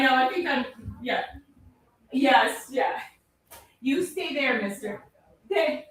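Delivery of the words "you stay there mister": talking to the dog. Real, not acted. Telling dog to stay.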